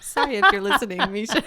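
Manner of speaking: mother laughing fondly to her daughter Misha; laughing apology. Real, not acted.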